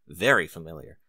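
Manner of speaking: plain reading voice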